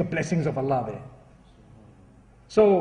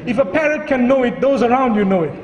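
raised, high-pitched sermon voice; impassioned preaching; 5 semitones higher, 4 dB louder than usual. Real, not acted. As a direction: drive the moral home to the congregation. Preaches the moral of the joke.

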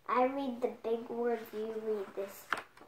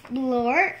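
loud nonsense word; 2 semitones higher, 10 dB louder than usual. Acted. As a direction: blurt out the nonsense word loudly. Nonsense word read out with relish.

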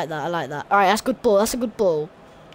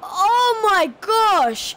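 shocked exclamation; 8 semitones higher, 5 dB louder, much slower than usual. Real, not acted. Shocked at a save.

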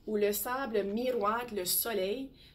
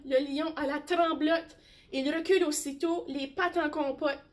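ordinary delivery, nothing marked

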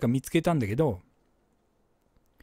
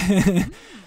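short laugh; 5 semitones higher, 6 dB louder than usual. Real, not acted. Laughs after apology.

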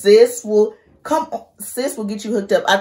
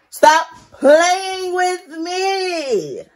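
mock whine; 11 semitones higher, 4 dB louder, much slower than usual. Mock outrage at her daughter.

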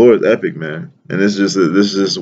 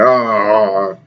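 wordless grumble, mimicking complainers; mumbled grumbling; slower than usual. Imitating thirsty Israelites complaining against Moses, mumbled grumbling. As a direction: grumble like a muttering, discontented crowd. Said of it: "mumbling and shit".

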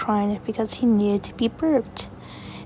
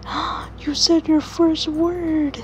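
delighted dad; 7 semitones higher, slower than usual. Acted. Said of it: "And James said".

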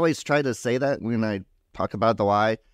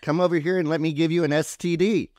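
higher-pitched retold holler across a room; half-raised, high calling-out voice; imitated yell; 6 semitones higher than usual. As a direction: call someone over in a mock yell. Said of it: "she yells across the room".

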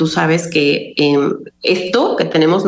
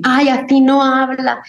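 mock high voice; 8 semitones higher than usual. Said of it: "mucha gente que ... hago mis en vivos así".

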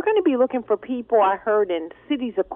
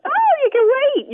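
high, slow imitation of the dummy; mock puppet voice; 4 dB louder than usual. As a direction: copy the puppet's squeaky voice. Host mimics puppet voice.

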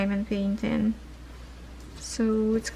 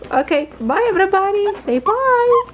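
much higher sing-song hello; high pet talk; 9 semitones higher, 5 dB louder than usual. Real, not acted. Owner prompting puppies to greet viewers, high pet talk. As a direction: chirp it up high for the pups.

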